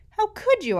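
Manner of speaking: high, loud disbelief; shocked. Acted. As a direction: cry out in outrage at the boys. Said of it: "I asked".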